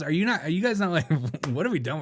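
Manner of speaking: ordinary talk, nothing marked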